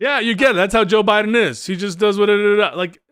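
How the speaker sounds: sarcastic mimicry of a shrugging apologist; mock parody. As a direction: throw his own line back at him, mocking. Mocking the other side's excuse.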